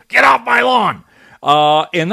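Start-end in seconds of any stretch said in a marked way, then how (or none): 0.1-1.0 high, loud mock yell at kids; old man yelling. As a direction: holler like a cranky old man chasing kids off. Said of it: "old men who yell at kids".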